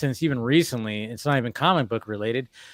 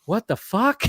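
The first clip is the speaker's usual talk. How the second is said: baffled; 8 semitones higher, slower than usual. Retold or imitated. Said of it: "they're like".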